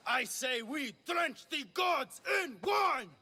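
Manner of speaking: overacted rallying cry; stage declamation. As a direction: declaim it to the back row. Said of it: "The next part is directed at Xerxes".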